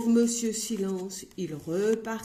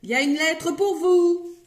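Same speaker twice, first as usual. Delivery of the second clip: much higher, loud announcement; shouted; 8 semitones higher, 8 dB louder than usual. Acted. Postman shouting about the letter. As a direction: call it out loudly. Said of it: "cria le facteur".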